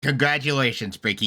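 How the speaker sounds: ordinary talk, nothing marked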